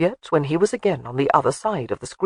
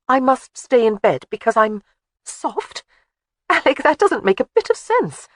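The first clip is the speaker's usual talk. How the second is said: lightly voiced wife arguing, higher; protesting wife, a touch higher; Jamie's voice; 8 semitones higher, 4 dB louder than usual. Wife arguing with her husband, lightly higher voice.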